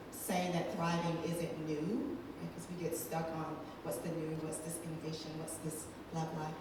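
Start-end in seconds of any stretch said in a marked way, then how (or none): none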